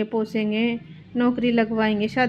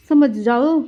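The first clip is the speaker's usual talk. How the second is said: louder, pressing warning on समझ जाओ; a daughter-in-law warning her mother-in-law, louder; stern warning; 3 semitones higher, 7 dB louder, slower than usual. Acted. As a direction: push समझ जाओ out hard like a threat.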